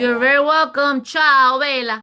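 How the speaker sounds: loud, high, playful farewell; bubbly ciao bella send-off; cheerful goodbye. Real, not acted. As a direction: sing out the goodbye warmly.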